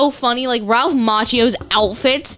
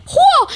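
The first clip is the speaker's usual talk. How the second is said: high loud mock karate yell; karate cry; 6 dB louder than usual. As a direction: yell Whoa like a fighter. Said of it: "my mom's like".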